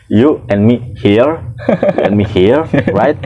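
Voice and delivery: slow, spelling-out English; guard speaking slowly to a tourist; slow careful English. Retold or imitated. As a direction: explain slowly to a foreigner. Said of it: "gue ngomong kayak gini".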